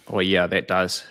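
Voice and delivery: normal talking voice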